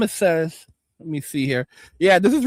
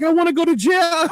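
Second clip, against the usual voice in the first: whiny recruit; 7 semitones higher than usual. Retold or imitated. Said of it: "And you're like".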